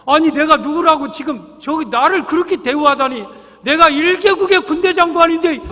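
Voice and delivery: loud, high indignant outburst; outraged general. Retold or imitated. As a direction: shout out the wounded pride of a great general. Insulted general rages.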